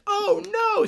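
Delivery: high, loud mock panic; hammed-up shriek of a freaking-out victim; mock panicked cry. Film fan acts out the freaking-out old lady, mock panicked cry.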